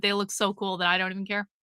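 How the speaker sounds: low, soft and fast; quick throwaway. Real, not acted. Streamer excusing too many sunglasses, quick and quiet. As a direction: toss it off quickly under your voice. Repeats line as throwaway, then emphatically.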